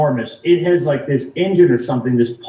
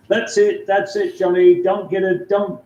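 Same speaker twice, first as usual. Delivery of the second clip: imitating a British friend, higher voice; British accent; 6 semitones higher than usual. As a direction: put on a light British accent, pitched up. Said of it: "in his accent, his British accent".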